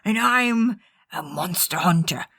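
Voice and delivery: put-on voice climbing into pirate; hammed-up monster hunter going piratical; Van Richten slipping into pirate. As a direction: let the character voice drift up into a pirate's. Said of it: "it's turning into a pirate".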